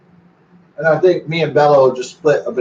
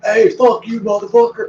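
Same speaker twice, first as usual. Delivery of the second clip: mock drunk yell; 8 semitones higher, 4 dB louder than usual. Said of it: "your Shia LaBeouf and you're like".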